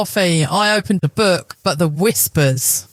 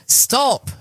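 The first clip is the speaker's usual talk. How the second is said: ordinary delivery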